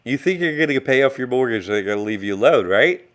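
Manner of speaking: usual speaking voice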